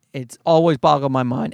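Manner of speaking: usual speaking voice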